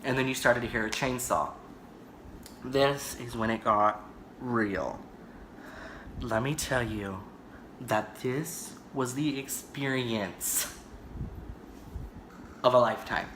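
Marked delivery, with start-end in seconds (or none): 2.6-13.3 low, slow, hushed storyteller; dramatic build-up. Real, not acted. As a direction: lower the voice and draw out each phrase for suspense.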